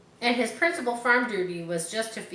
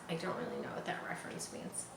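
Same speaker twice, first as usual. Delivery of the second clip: whispered aside; 6 semitones lower, 13 dB quieter, faster than usual. Real, not acted.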